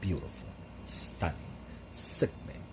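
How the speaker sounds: breathy admiring whisper; whispered praise. Real, not acted. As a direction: whisper it in awe. Admiring her makeup.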